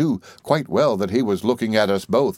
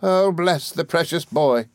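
high, drawn-out exclamation; slow, high nurse fussing over a child; fond cry; 9 semitones higher, slower than usual. A narrator's reading. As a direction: exclaim it in loving alarm. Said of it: "cried Peggotty".

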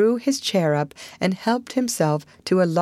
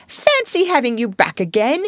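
higher-pitched happy exclamation; beaming delight; 6 semitones higher, 4 dB louder than usual. A narrator's reading. Mole welcoming Toad back, delightedly. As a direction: marvel at a friend's return. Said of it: "cried the Mole, his face beaming".

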